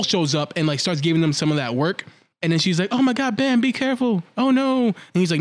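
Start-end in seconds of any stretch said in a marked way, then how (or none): 2.9-4.9 high-pitched mock worry; retold alarm. Girl warns Bam as the monster attacks, high mock alarm. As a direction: imitate a worried warning to a friend.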